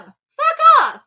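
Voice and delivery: high, loud angry yell; angry shout. Real, not acted. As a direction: yell it, fed up. Ranting about people offended for him.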